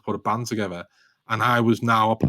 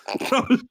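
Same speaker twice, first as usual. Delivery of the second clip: giggle; 13 semitones higher than usual. Real, not acted. Laughing recalling Glastonbury offer.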